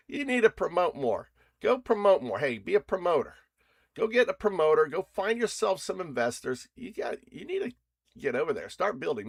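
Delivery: game demo, yelling at agents, mock-loud; mock shouted orders. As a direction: play a boss yelling at lazy workers.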